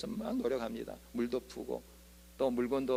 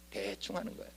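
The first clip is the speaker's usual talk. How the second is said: whispered, confiding remark; whispered aside; 3 semitones higher, 10 dB quieter than usual. Real, not acted. Aside about half-hearted obedience.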